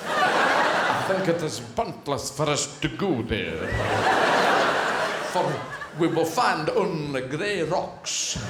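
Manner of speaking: slow, low bad Russian accent; hammed-up Russian accent. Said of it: "I've borrowed this accent from a shop".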